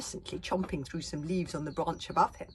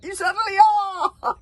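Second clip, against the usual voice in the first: high, loud, slow joyous shout; joyful crowd cheer; 16 dB louder, slower than usual. A narrator's reading. Joyful crowd cheering back at the chief. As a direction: cheer it with delight. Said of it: "clapped the crowds with joyful".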